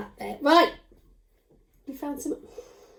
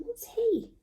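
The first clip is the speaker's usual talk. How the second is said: usual speaking voice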